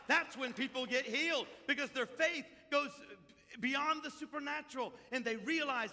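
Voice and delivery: loud, high revival preaching; fiery pulpit cry; preaching at full force. Real, not acted. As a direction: preach it out to the whole room.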